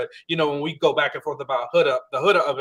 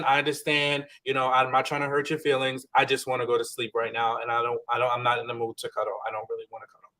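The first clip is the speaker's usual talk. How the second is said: gentle acknowledgement; 5 semitones lower, 6 dB quieter, faster than usual. Pretend. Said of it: "you just acknowledge the person".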